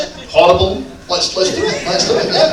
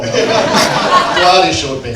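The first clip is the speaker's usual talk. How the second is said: laughing; much slower than usual. Real, not acted. Host laughs at his mistake.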